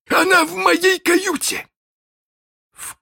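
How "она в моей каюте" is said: high, loud pirate shout. Acted. Hook shouts to Jukes across the deck.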